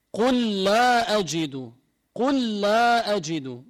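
قل لا أجد slow, the two lams joined into one doubled lam; merged double lam. Shown as a demonstration. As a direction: join the lam of قل into لا. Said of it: "ندغمهم". (إدغام اللامين في قل لا أجد)

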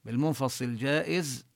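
ordinary talk, nothing marked

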